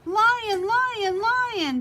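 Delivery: high, loud alarmed cries; panicked shout. A narrator's reading. Badger spotting the lion and shouting in panic.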